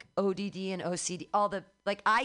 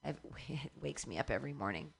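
ordinary delivery

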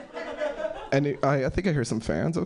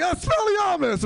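high, slow incantation; mock spellcasting; 10 semitones higher than usual. Teacher casting back at pupil in playground duel, mock dramatic incantation.

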